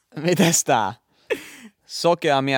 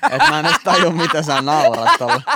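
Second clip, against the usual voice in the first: fast and louder through laughter; laughing; 6 dB louder than usual. Real, not acted. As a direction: laugh out the complaint. Laughing while mocking the joke.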